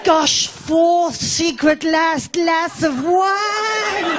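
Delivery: slow, high, drawn-out delight; mock triumph. Comic miming the last squeeze of the wine bag, drawn out. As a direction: savour the last drop theatrically.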